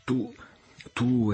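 lightly voiced girl crying out in joy; mad with joy. A narrator's reading. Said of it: "esclamò la giovinetta pazza di gioia".

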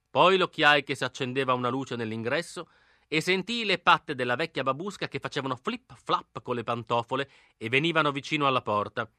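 ordinary delivery

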